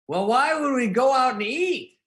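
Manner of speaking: high, loud, slowed indignant whine; mock Norm protest. Norm refusing dinner, hammed-up indignant whine. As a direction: whine it like a fussy friend. Norm voiced in retold text.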